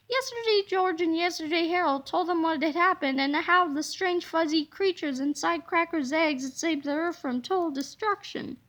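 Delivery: plain reading voice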